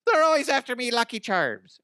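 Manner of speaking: high-pitched cartoon Irish brogue; exaggerated Irish leprechaun; Lucky Charms guy. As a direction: squeal it in a stage-Irish accent. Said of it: "I'm just doing Lucky Charms guy".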